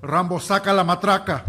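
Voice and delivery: loud parody of tongues-speaking; mock speaking in tongues. Pastor speaking in tongues to the congregation, loud mock chant. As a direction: belt out fake tongues like a fervent preacher. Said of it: "why the pastor is speaking in tongues".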